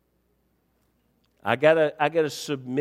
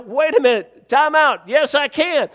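raised, higher voice arguing back; retold protest; 8 semitones higher, 5 dB louder than usual. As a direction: push back at the officer in a retelling. Retold argument with officer.

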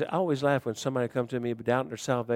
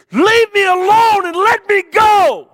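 slow, high, anguished yell; furious crying shout; 18 dB louder, slower than usual. Retold or imitated. Angry student yelling at the preacher, crying and shouting. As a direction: explode at him with tears in the voice. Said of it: "And he said".

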